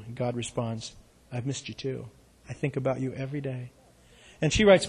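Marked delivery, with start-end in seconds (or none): 1.3-3.7 much softer, gentle voice of God; tender God voice. A narrator's reading. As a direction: speak as a loving God, hushed. God voiced in read poem.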